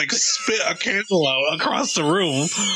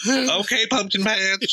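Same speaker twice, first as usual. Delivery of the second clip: high sing-song tease; high sweet coo at the villain; teasing girlfriend; 5 semitones higher, slower than usual. Retold or imitated. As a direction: coo the pet name sweetly.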